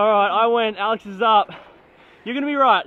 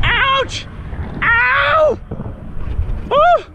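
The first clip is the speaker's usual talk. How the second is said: excited yelling; 14 semitones higher, 3 dB louder, much slower than usual. Real, not acted. After the jump.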